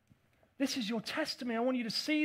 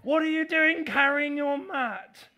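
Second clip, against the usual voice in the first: mock indignant critic; 5 semitones higher, 8 dB louder than usual. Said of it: "it's a li".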